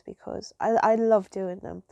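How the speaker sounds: normal talking voice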